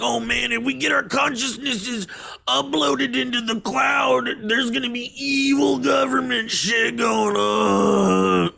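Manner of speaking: high, wailing mock dread; hammed-up alarm, stretched into a whine at the end; mock panic. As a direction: wail it like an overblown doomsayer. Mocks panicky reaction to sci-fi movies.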